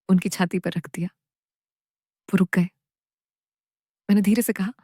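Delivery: usual speaking voice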